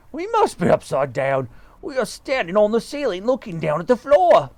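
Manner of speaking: lightly voiced alarmed husband; husband working out they are upside down; a hint of panicky Mister Twit. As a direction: speak up in mild panic.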